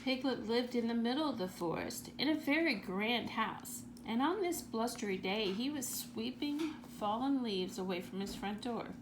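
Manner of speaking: plain reading voice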